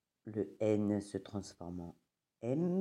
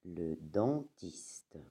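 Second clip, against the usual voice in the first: dentiste said slowly, each syllable clear; slow dictation reading; 3 semitones lower, 4 dB quieter, much slower than usual. Shown as a demonstration. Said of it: "slow model reading".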